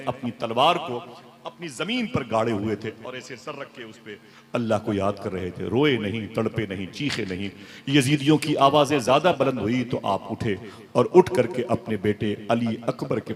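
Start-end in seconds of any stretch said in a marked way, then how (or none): none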